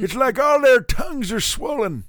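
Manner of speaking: put-on grumpy old man.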